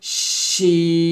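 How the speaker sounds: শিন with hissed sh and long held ee; long drawn SHIN. Shown as a demonstration. Teacher models shin for students, long drawn শিন. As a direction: hiss into শিন, then stretch the vowel to n. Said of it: "তারপর উচ্চারণটা করবেন".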